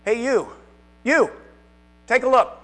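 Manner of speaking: a loud, high call across the room; a sudden loud shout to the listeners. Shown as a demonstration. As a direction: call out to grab attention. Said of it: "I saw several heads move".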